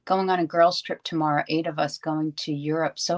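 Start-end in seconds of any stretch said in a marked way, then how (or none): none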